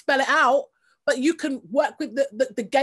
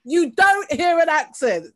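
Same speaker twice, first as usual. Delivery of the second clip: slow, louder stress on DON'T in "don't hear"; indignant rebuttal; 3 semitones higher, 7 dB louder, slower than usual. Real, not acted. Listener rebutting the absent teacher, indignant and emphatic. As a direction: draw out "DON'T hear an accent", firmly.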